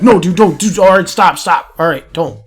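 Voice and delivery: loud, hurried-sounding protests in a retold voice; mock panicked pleading. Bar back begging Buddha not to strike, mock panic. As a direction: imitate someone frantically calling off a threat.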